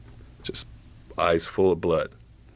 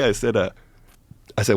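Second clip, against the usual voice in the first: ordinary talk, nothing marked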